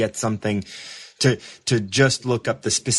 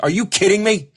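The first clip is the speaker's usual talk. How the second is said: much higher, louder incredulous outburst; fed-up are-you-kidding-me cry; exasperated disbelief; 10 semitones higher, 5 dB louder than usual. Retold or imitated. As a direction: throw up your hands at the page. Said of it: "I was so irritated".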